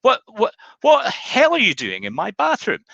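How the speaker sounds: high, loud indignant yell; husband finding a naked man in his bathroom; outraged husband. Acted. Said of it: "the husband says".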